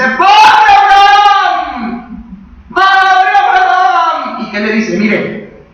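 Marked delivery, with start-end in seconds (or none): 0.0-4.4 long, high, drawn-out desperate shout; tormented scream. Acted. Rich man in hell begging Abraham, acted agonized scream. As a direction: cry out with vocal cords straining, stretching the name. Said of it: "en su tormento y le grita".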